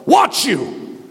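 high, slow, shouted commands; rally the troops with each command; war cry. Acted. Paul exhorting the church at Corinth, war cry. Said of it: "That is literally a war cry".

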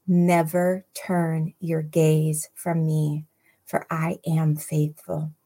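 "Never turn your gaze from me" drawn out word by word; slow right down so every word sinks in; slow, deliberate repeat. Real, not acted. Preacher repeating the verse so listeners hear that, slow and deliberate. Rereads after "Did you hear that?.

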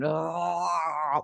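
a half-conscious "oh"; mock groggy groan. Waking from anaesthetic, mock groggy moan. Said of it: "like, really sexy".

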